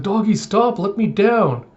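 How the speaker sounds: put-on high, childish plea to stop; high little girl begging the dog; little girl pleading. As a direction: squeak it like a kid being dragged along. Anya pleading as dog runs.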